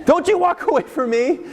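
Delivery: high, shrill-pitched command to stop; indignant parent. Acted. Parent yelling at a teen walking away, outraged. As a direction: order them back, outraged.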